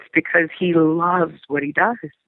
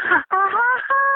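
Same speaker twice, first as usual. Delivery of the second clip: very high, squeaky excited hi; giddy phone hello. Retold or imitated. Calling back her director, squealing a high excited hello. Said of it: "Not freaking out, but like".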